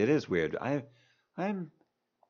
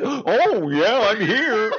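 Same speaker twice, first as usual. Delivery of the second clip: imitating Nick Cage; 10 semitones higher, 7 dB louder, much slower than usual. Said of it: "it's like".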